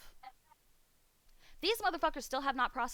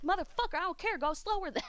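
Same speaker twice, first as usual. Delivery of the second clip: higher-pitched exasperated scolding; livid retort; 8 semitones higher than usual. Retold or imitated. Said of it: "how I'm fucking livid".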